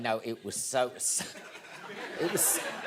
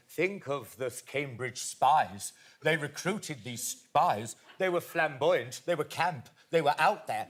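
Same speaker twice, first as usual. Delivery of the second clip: slow Stephen Fry impression; 3 semitones lower, slower than usual. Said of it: "Stephen Fry was going".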